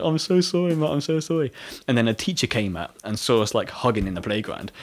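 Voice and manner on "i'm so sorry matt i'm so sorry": pleading apology. Retold or imitated.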